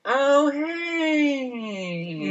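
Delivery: high, long drawn-out mock greeting; Summer Rae. Imitating Summer greeting confused Rosa, high and sugary put-on voice. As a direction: pitch it way up and stretch the hey. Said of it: "in Summer's voice, she's like".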